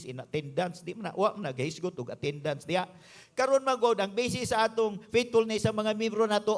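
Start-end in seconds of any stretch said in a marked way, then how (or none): none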